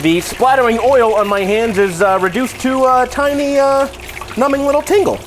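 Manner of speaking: tipsy and a little loose. Real, not acted. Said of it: "The alcohol that we drank earlier".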